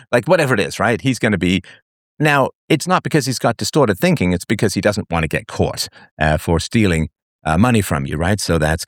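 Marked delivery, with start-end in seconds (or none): none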